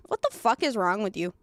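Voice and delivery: ordinary talk, nothing marked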